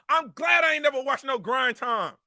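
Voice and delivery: usual speaking voice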